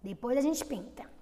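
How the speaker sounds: ordinary delivery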